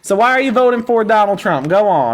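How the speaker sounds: drawling mock country accent, lightly done; country accent slipping in and out; put-on country accent. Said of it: "whatever accent that was".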